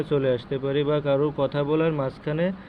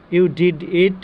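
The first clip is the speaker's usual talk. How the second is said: "You did it" said louder with a strong push on the words; emphatic stress; 3 semitones higher, 5 dB louder, much slower than usual. Shown as a demonstration. Teacher showing students how to stress "You did it" loudly. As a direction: punch "You did it" hard and loud. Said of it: "আবার কখনো জোর দিয়ে বলতে পারি".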